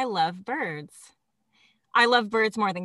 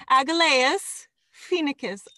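slow, louder, rolling Italian lilt on Aglaeus phoenicus; Italian-flavoured Latin; 5 semitones higher, 5 dB louder, slower than usual. Real, not acted. Podcast host reading a bird's Latin name, mock Italian accent. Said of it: "I become Italian when I'm saying".

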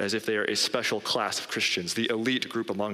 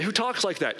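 higher-pitched mock disbelief; incredulous objection; 10 semitones higher than usual. Preacher voices a listener objecting to Jesus's bluntness, incredulous. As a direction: voice a taken-aback listener's challenge.

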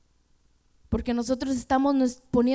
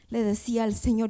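normal talking voice

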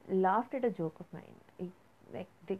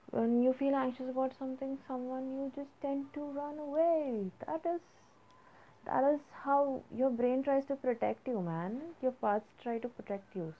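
worked up, higher-pitched explaining; rushed; 7 semitones higher than usual. Real, not acted. Podcaster getting carried away explaining anxiety, rushed and higher. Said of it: "okay, I'll just slow down".

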